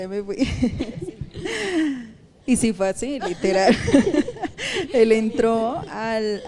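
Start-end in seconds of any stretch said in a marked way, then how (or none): 0.4-2.1 higher falling laugh. Real, not acted. Ríe al contar la declaración. 2.5-3.8 words through laughter, louder; laughing. Real, not acted. Cuenta riéndose la declaración. 3.8-4.9 high loud laugh. Real, not acted. Ríe al contar la declaración. 4.9-6.4 words through laughter, louder; laughing. Real, not acted. Cuenta riéndose la declaración.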